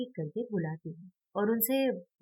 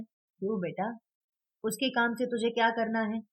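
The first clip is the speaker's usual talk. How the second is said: ordinary delivery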